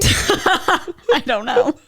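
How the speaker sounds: high, slow, through laughter; laughing. Real, not acted. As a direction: giggle the words out.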